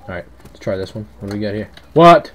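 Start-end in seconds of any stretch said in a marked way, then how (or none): 1.9-2.3 loud, high startled question. Real, not acted. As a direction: blurt it out in shock. Startled by something in the game.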